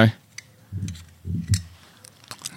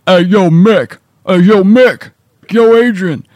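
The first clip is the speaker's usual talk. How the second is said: louder, rough Rocky voice; Rocky impression; 5 semitones higher, 4 dB louder, slower than usual. Shown as a demonstration. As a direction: mumble it like a Philly boxer. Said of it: "only thing I know how to say that even remotely sounds like Rocky".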